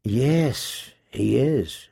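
soft, low murmur; intimate whisper. A narrator's reading. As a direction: whisper it softly to a lover. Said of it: "she whispered".

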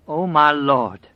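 great, slow, loud cry; quavering cry. A narrator's reading. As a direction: cry out to the Lord, trembling. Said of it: "she cried in a great and quavering voice".

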